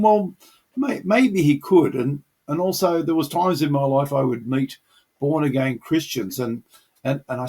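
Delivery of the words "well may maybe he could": doubtful musing. Retold or imitated. Imitating former doubting self.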